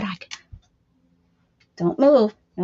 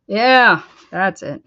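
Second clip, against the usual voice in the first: ordinary talk, nothing marked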